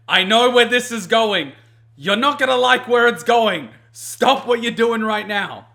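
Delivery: high, loud, slowing on "stop what you're doing"; escalating alarmed warning; urgent loud warning. Retold or imitated. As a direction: shout a command to stop. Warning friend about the danger.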